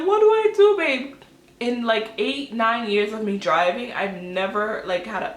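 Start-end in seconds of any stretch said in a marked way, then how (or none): 0.1-1.1 high, loud mock wail; tearful pitched-up plea, imitated; retold crying. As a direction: act out wailing to your partner.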